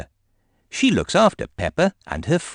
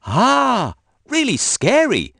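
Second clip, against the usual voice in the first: much higher, mock-scared and amused; laughing teacher; 4 dB louder, slower than usual. A narrator's reading. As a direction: play along with a laugh in it. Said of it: "laughs Madame Gazelle".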